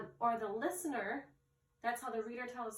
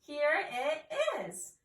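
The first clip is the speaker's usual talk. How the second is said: louder, drawn-out exclamation; excited; 3 semitones higher, 8 dB louder, slower than usual. Shown as a demonstration. Teacher shows how to exclaim at an exclamation point, excited. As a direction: exclaim it with excitement, slow and loud. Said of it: "We need to be excited".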